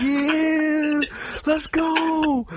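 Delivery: high, excited mock hype; hyped-up friend. Friend hops in on the twerking, high hype cheer.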